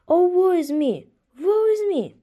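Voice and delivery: high, loud wail of woe; crying out in grief. Acted. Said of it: "cried the snipe".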